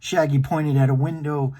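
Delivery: ordinary delivery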